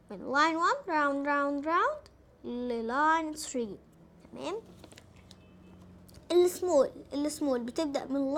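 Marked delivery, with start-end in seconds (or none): 0.1-3.8 slow. Shown as a demonstration. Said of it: "teacher tracing letter stroke slowly".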